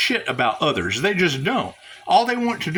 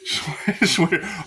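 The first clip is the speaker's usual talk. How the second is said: ordinary delivery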